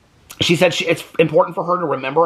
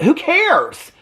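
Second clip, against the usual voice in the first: higher, incredulous who cares; exasperated; 11 semitones higher, slower than usual. Real, not acted. Vlogger fed up with Kody's Buffalo Bill story, exasperated and much higher. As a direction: throw up your hands in disbelief.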